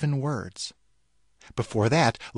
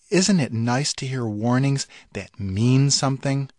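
usual speaking voice

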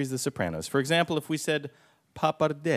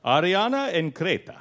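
louder, theatrical Italian; Italian accent; 3 semitones higher, 3 dB louder than usual. Pretend. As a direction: announce it in Italian style. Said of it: "Your Italian accent is phenomenal".

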